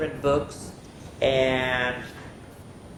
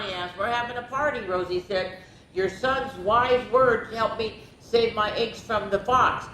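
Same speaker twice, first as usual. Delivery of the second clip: lively picture-book reading; expressive bedtime-story narrator; story read-aloud; 4 semitones higher than usual. A narrator's reading. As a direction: read it to children, voicing each animal lightly.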